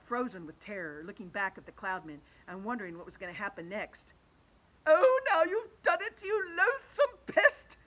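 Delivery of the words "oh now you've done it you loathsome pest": high, loud, tearful reproach; frantic earthworm. Acted. Earthworm reproaching the centipede, high tearful scold. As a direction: wail the blame at him. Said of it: "whispered the earthworm".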